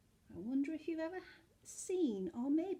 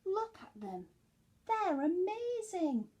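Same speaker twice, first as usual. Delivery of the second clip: amazed; 7 semitones higher, 7 dB louder, slower than usual. Acted. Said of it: "said Norman".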